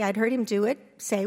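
normal talking voice

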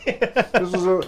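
talking through a mouthful of noodles; mouth full. Real, not acted. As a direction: say it while chewing, mouth full. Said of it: "talking into the radio with my mouth full".

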